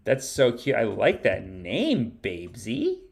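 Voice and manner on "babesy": ordinary talk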